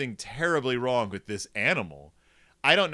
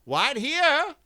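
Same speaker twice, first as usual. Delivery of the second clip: high, loud farm-wife call; Missus Ben; 11 semitones higher, 5 dB louder than usual. Acted. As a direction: sing out in a put-on high voice. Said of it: "says Missus Ben".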